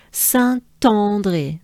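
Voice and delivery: "Saint-André" slowly, with the T carried into André; French liaison, sann-tahn-dray, on "Saint-André"; linking T. Shown as a demonstration. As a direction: sound the T clearly in "Saint-André". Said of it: "the T is important".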